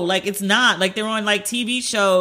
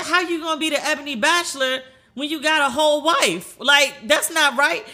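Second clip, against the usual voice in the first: high, indignant scolding; mock scolding; 7 semitones higher than usual. Daughter scolding her dad about being a bachelor, mock indignant. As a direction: call out her dad, half joking. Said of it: "I said".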